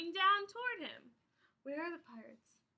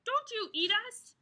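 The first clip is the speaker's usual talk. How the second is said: higher, louder defiance; defiant shout; 6 semitones higher, 4 dB louder than usual. Acted. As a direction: stand up to the giant. Captain defies the giant.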